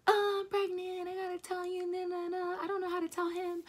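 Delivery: crying to best friend Kim that you're pregnant. Retold or imitated. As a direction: cry it out to your best friend. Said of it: "I just start bawling".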